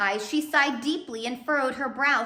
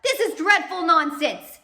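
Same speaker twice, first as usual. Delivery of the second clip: indignant outburst; 7 semitones higher, 8 dB louder than usual. Acted. Mr Poe indignant.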